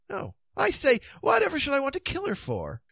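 high-pitched puzzled king; high, dithering character voice; Pellinore, baffled. Acted. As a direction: ask high, in innocent bewilderment.

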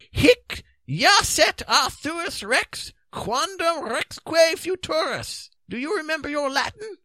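high, emphatic wizard voice; high, theatrical Merlin voice; Merlin prophesying. Acted. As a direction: proclaim it high, drawing out the Latin. Merlin foretells in character.

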